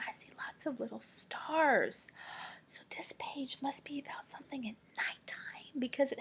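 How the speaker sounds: soft breathy whisper. Real, not acted. Teacher whispers about the page to kids. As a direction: hush it to draw them in.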